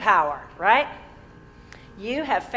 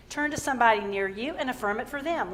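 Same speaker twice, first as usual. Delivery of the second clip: ordinary talk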